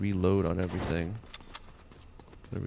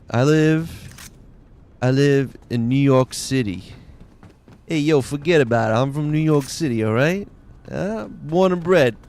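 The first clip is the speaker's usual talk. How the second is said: louder hammed-up New York accent; mock New York accent; 3 semitones higher, 6 dB louder than usual. Streamer jokes about his New York roots in a mock accent. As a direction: brag it like a tough New Yorker. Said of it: "That's the accent you hear".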